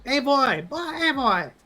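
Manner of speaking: high squeaky Pokémon cries; cute Eevee voice. Pretend. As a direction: chirp it like a little Eevee. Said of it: "It's fun to be an Eevee".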